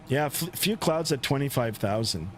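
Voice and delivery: ordinary talk, nothing marked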